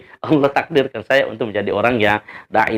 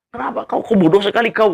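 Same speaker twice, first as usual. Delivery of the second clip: higher, sharp scolding of a foolish convert; retold angry scolding; 9 semitones higher than usual. Manager scolding the new convert, mock angry. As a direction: snap at him like an offended boss. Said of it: "dia dengar dan dia marah".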